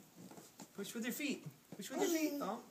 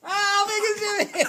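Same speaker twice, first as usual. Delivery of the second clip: giggly tickle attack; 4 semitones higher, 10 dB louder, slower than usual. Real, not acted. Playful tickling of toddler.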